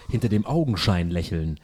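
low, soft mock eerie intro voice; eerie announcer voice. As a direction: lightly imitate a creepy TV voice. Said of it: "der sagt Augenscheinlächeln".

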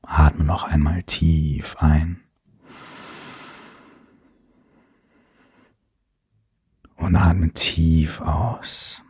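calm, unhurried breathing cues; guided meditation. Acted.

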